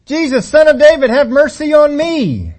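high, loud, pleading cry; loud shout. Retold or imitated. Beggar crying out to Jesus, loud shout. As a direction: call out with everything you have. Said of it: "he is shouting as loud as he can".

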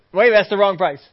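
acted customer calling out a mistake; indignant customer. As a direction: object loudly at the register. Acted customer disputing a price.

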